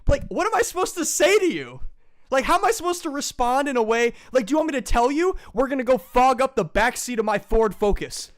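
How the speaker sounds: high, loud, worked-up ranting; exasperated rant. Real, not acted. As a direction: vent at the whole idea. Ranting at father.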